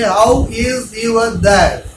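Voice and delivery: slow, stiff "How is your dad?" with every word drawn out; wrong slow version. Shown as a demonstration. Teacher showing students the wrong way, slow and stiff. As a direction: drag each word out like a beginner. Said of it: "Don't say".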